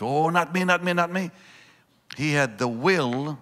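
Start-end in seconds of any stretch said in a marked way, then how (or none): 0.0-1.3 high, pleading denial; insistent protest. Retold or imitated. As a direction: protest like Peter swearing he never would. Peter protests to Jesus.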